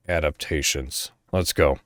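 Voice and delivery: ordinary delivery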